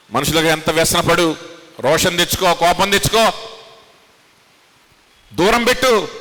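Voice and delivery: rising from firm to high and loud; preacher building to a peak; building preaching. Acted. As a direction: climb with each phrase.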